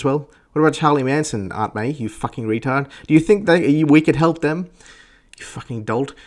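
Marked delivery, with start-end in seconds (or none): none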